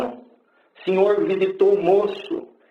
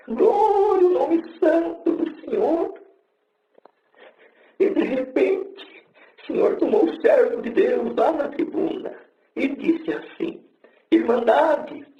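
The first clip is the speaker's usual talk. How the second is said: fervent preaching; 9 semitones higher than usual. Acted. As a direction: preach it out, lifting the church. Testimony builds into preaching.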